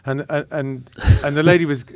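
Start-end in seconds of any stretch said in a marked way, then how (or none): none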